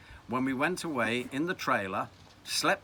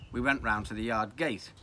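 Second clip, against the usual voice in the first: ordinary delivery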